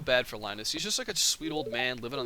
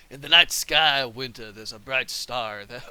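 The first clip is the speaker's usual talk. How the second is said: lightly voiced old sailor; a hint of a weathered sea captain; old salt voice; 7 dB louder than usual. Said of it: "I should give him an old salt voice".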